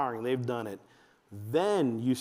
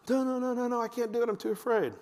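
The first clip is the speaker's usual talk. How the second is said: very high mock-frightened child; put-on childish fear; panicky kid; 10 semitones higher than usual. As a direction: rattle off a scared kid's refusal. Acting the scared kid refusing.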